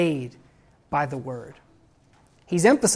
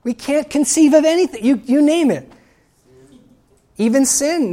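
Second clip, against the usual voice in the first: much higher and louder, rising excitement; fervent preaching; 8 semitones higher, 6 dB louder than usual. Real, not acted. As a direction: preach it out with growing fire to the congregation. Preacher building to a climax.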